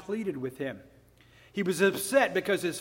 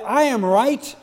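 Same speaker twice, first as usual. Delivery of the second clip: louder, higher boastful claim; proud self-righteous; 3 semitones higher, 7 dB louder than usual. Retold or imitated. Self-righteous son boasting to God, proud. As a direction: puff up and claim you deserve it. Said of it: "He was proud".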